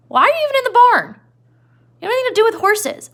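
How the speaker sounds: challenging the intruder; Crooks. Retold or imitated. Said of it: "he was like".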